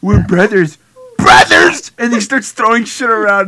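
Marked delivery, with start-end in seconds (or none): 0.0-1.9 shouted, then a high raw scream; hammed-up furious shout; mock rage. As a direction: build from yell to scream. Acted Josh rage.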